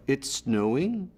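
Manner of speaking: slow, snowing lifted up like a question; Canadian upturn on snowing; Canadian rise. Shown as a demonstration. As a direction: make the statement rise at the end. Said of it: "every statement sounds like a question".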